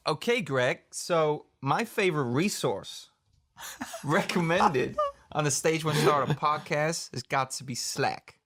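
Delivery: hammed-up American accent, drawn out; bad American accent. Shown as a demonstration. Co-host answering Greg's question on a dare, bad American accent. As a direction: put on a cheesy American voice, nearly cracking up. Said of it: "really bad accent that I'm about to give".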